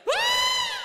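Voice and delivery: high shrill scream; wife's sudden high scream. Retold or imitated. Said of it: "istrinya tiba-tiba teriak".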